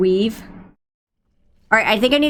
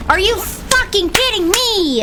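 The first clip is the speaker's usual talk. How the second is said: high, slow, drawn-out shout; exasperated yell; 11 semitones higher, slower than usual. Real, not acted. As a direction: yell it in disbelief. Ambushed again, exasperated.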